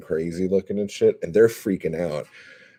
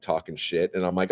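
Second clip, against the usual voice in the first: ordinary talk, nothing marked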